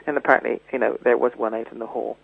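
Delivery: ordinary delivery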